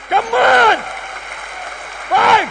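loud, high burst of disbelief; loud amazed outcry; astonished exclaim. Acted. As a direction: shout it out in amazement.

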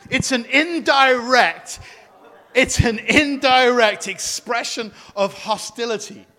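slow, loud, laughing repeat; laughing emphasis. Real, not acted. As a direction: drive it home through laughter.

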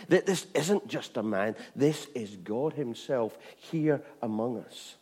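ordinary talk

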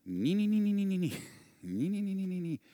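very high-pitched repeated "nee"; imitated squeal. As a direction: mimic a squealing, straining noise in quick bursts. Imitates noise of trying to flee.

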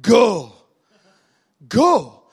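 loud command in a northern accent. Shown as a demonstration. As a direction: punch it out with a northern English vowel. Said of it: "In my northern accent".

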